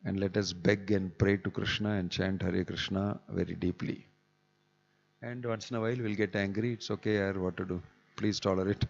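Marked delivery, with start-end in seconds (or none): none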